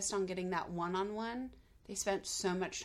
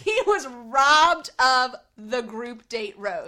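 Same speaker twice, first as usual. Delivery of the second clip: loud, slow, emphatic protest; mock outrage; 2 semitones higher, 13 dB louder, slower than usual. Real, not acted. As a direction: hammer out each word at full volume. Insisting the contestant was robbed.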